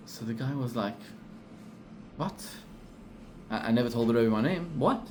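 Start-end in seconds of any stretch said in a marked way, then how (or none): none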